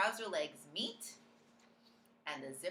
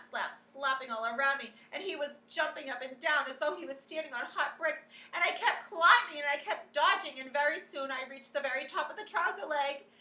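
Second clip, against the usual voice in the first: usual speaking voice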